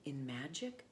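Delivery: put-on steady storybook read for children; reading a story aloud. A narrator's reading. Presenter reads Malala's story to young listeners, storybook voice. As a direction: tell it like a bedtime book.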